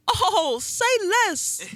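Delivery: put-on New York kid. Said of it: "I'ma say it like that New York kid".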